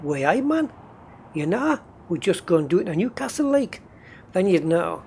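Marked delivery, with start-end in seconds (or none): 0.0-3.8 mild Geordie, way aye man, a little higher; lightly put-on Newcastle accent. Shown as a demonstration. Proving he still has it, a light Geordie accent. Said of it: "if I was saying things like".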